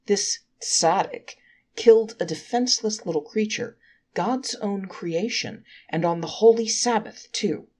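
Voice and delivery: accusing angel mildly gloating before the court; triumphant. Acted. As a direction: press the charge home with a touch of triumph. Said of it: "Triumphantly the accusing angel turned".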